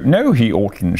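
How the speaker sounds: ordinary delivery, nothing marked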